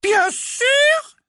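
high, slow, sugary sweet voice; syrupy menace, drawn out; wicked stepmother. A narrator's reading. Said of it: "avec un sourire toujours aussi large".

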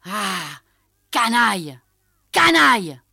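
building from stammer to high, very loud rage; stammering, escalating fury; furious sputtering. A narrator's reading. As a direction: choke on fury, then explode. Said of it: "saisi soudain d'une colère folle, balbutia".

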